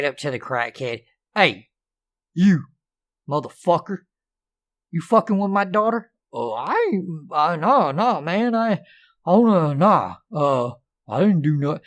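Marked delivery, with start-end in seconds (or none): 1.4-6.1 dad confronting. Acted. 6.3-11.8 flustered crackhead. Acted.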